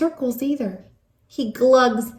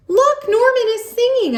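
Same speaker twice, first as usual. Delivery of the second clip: much higher, delighted exclamation; excited; 12 semitones higher, 3 dB louder than usual. Acted. Child telling the class that the goldfish sings. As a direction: squeal it out with delight.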